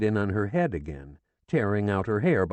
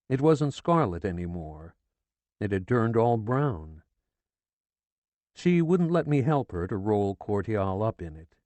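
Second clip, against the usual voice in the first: plain reading voice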